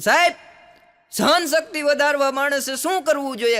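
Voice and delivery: put-on high, loud childlike call to the teacher; eager young pupil. Schoolboy asks teacher about patience, high eager call. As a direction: pipe up like a small schoolboy.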